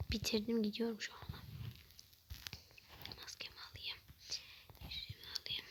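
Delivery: very soft whisper. Real, not acted. Vlogger whispering to viewers as she sneaks out. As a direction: barely breathe it. Leaving quietly.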